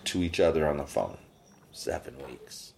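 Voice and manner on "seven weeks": whispered for dramatic effect; hushed emphasis. Real, not acted. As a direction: drop to a whisper to drive the point home.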